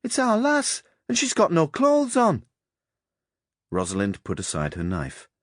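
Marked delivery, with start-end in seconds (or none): 0.0-0.8 put-on high, loud "It's our lass" in a child's Yorkshire voice; insistent Yorkshire child calling out; Yorkshire boy's shout. A narrator's reading. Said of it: "sounding like some vulgar tyke". 1.1-2.5 high, loud little Yorkshire tyke; excited young boy yelling in broad Yorkshire; Yorkshire boy's shout. A narrator's reading. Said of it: "sounding like some vulgar tyke".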